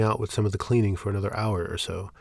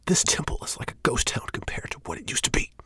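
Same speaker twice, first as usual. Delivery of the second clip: urgent, forceful whisper; raised whisper; 4 semitones higher than usual. Acted. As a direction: hiss it under your breath. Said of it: "in a raised whisper".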